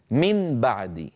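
من بعد slowly, with a full N before the B; clear unmerged nun. Shown as a demonstration. Teacher asks students to try it, clear nun. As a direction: hold the tongue-tip N in من, then say بعد. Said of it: "انطقوا نونا مظهرة".

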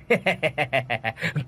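long mocking villain chuckle; leering chuckle. A narrator's reading. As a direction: chuckle lewdly at a victim. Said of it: "bắt đầu cợt nhả nói".

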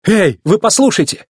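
lightly acted shout to a crowd; calling out. A narrator's reading. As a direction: call out to get everyone listening. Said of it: "крикнул он".

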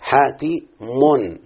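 حاتي من split into very slow separate beats; syllable by syllable. Shown as a demonstration. Teacher to pupils splitting the name Hatim, very slow syllables. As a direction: spell out the name very slowly. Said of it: "اخونا ده اسمه حاتم".

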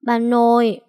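put-on small boy calling grandma through tears; whimpering call. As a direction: call out to grandma, lips trembling. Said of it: "mếu máo gọi".

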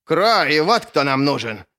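loud barked retort; sharp, forceful comeback; almost barking. A narrator's reading. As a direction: bark it straight back at a rival. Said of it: "почти лаял в ответ тот".

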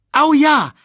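high, loud whoop of agreement; eager cry. A narrator's reading. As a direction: cry out like an eager young cub. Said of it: "rief Simba".

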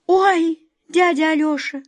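gasp, then a high pained cry; distressed little girl; sorrowful exclamation. A narrator's reading. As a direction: cry out in dismay. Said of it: "горестно воскликнула Катя".

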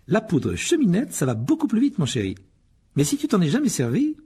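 a brisk mother of many sons; Mrs Weasley. Acted. Molly voiced. (voix de mère plus aiguë)

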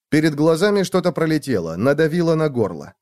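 ordinary delivery, nothing marked

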